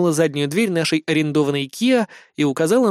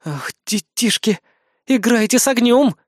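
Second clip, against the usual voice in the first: snorting scorn; 7 semitones higher, 3 dB louder than usual. A narrator's reading. Azumi warning the teasing officers, scornful. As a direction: scoff at them in a girlish voice. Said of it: "фыркнула Азуми".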